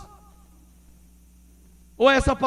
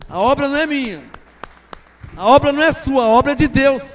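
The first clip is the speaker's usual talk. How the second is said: proclaimed louder; 3 semitones higher, 7 dB louder, faster than usual. Acted. Refrain repeated for the congregation.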